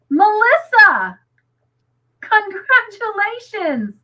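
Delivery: high, slow, drawn-out cheer; elated congratulations. Real, not acted. Congratulating a viewer on her milestone, elated. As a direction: celebrate with big swooping high pitch.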